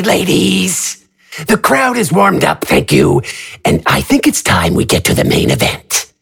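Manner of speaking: high theatrical villain, smug; a smug, high showman announcing. Acted. Narrator voices AJ character.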